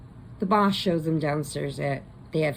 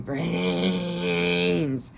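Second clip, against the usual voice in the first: slow, drawn-out, loud zombie cry for brains; the corpses rising from the cemetery, slowly wailing; zombie moan; 4 dB louder, much slower than usual. Retold or imitated. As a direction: moan it like the walking dead. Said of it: "and they're going".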